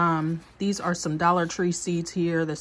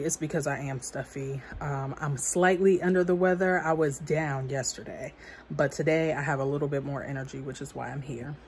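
usual speaking voice

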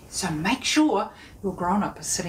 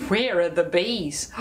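ordinary talk

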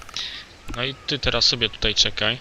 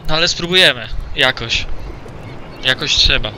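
hyped up; 2 semitones higher, 5 dB louder than usual. Real, not acted. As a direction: psych yourself up before the hard part. Gracz nakręca się przed trudnym fragmentem. (głośno i z nakręceniem)

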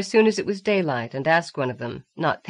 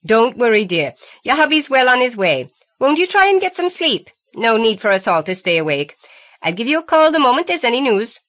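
higher, louder motherly phone voice; reassuring older woman; 7 semitones higher, 7 dB louder than usual. A narrator's reading. The woman on the phone soothing Allison, reassuring. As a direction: soothe the worried caller kindly.

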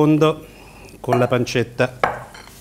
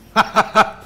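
short laugh; 10 semitones higher, 4 dB louder than usual. Real, not acted. Laughing at the hot bite.

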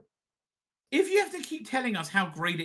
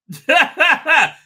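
loud, higher burst of laughter; delighted laugh; 12 semitones higher, 11 dB louder than usual. Real, not acted. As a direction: laugh at your own slip. Laughs at Fishish Bar slip.

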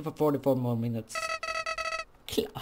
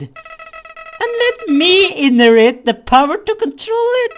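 high, loud menacing flower; Flowey voice; 10 semitones higher, 10 dB louder than usual. Acted. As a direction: scheme aloud, high and loud. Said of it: "my flowery voice".

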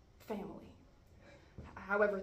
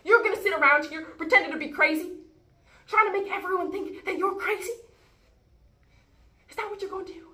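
high, very loud angry tirade; furious accusation; 10 semitones higher, 14 dB louder than usual. Acted. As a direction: shout the accusation in exasperation. Furious quarrel, accusing sibling.

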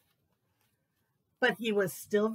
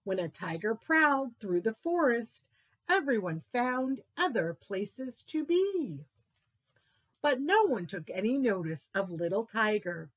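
ordinary delivery, nothing marked

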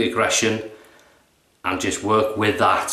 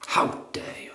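soft unvoiced mock indignation; whispered snob; 6 dB quieter, much faster than usual. Snob's line repeated to himself, whispered mock outrage. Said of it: "the shaving snob".

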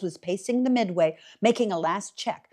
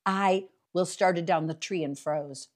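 usual speaking voice

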